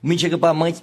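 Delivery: usual speaking voice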